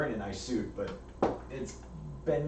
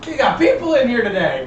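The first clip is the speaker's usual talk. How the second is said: loud, high, jovial exclamation; delighted showman; 9 semitones higher, 11 dB louder than usual. Acted. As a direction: boom it out like a host thrilled by a crowd. GM voices Rick, delighted.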